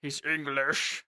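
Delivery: slow, low, soft put-on English accent; Henry's English accent. Player demonstrating the character, slow English accent. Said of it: "I have to do a Henry voice".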